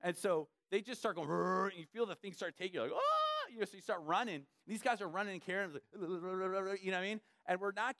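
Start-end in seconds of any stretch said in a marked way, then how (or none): 2.9-3.5 high startled ah as the sail pulls; startled yelp. Retold or imitated. Pastor recalls being yanked by parasail, yelping high. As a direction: yelp as you're yanked off your feet.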